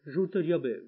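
ordinary delivery

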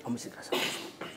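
a hushed confidential repeat; breathy whisper under the voice; whispered aside. Acted.

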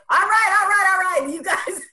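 exasperated giving in. Pretend. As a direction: cave in loudly to the pep talks. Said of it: "you may just say".

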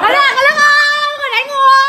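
real high-pitched public outcry; calling out for witnesses. Real, not acted. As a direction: cry out the alarm to everyone around. Crying out to bystanders about assault.

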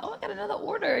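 high, laughing squeal of happy surprise; delighted surprise. Retold or imitated. Thrilled seller squeals at another order at night. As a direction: squeal about a new order coming in.

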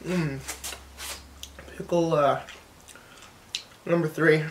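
words through a chewed mouthful; mumbling mid-chew with closed lips; chewing while talking. Real, not acted. Seen 0: Pickle bitten at -5; cheeks and lips keep chewing until 5.0.

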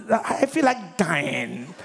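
slow, drawn-out lovesick moan with a laugh; hammed-up heartbroken complaint; mock despair. Mocks woman upset over no flower.

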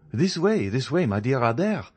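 high-pitched, hearty call to a friend; eager welcome. A narrator's reading. Journalist receiving a friend at his office, eager welcome. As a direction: usher a friend in, pitch lifted.

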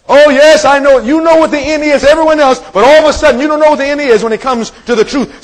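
high, loud preacher's mockery; impassioned preaching. Preacher mocking those who dodge the truth, impassioned.

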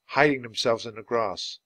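ordinary delivery, nothing marked

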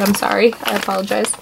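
ordinary talk

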